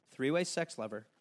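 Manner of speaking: normal talking voice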